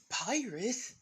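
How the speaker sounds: high, loud eager question; excited. Acted. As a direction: burst out thrilled. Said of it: "With excitement".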